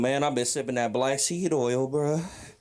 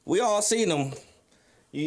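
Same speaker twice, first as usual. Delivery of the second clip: ordinary talk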